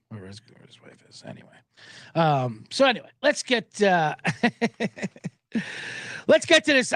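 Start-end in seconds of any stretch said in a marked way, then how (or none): 0.1-1.5 mumbled aside. Real, not acted. Trailing off into mumble.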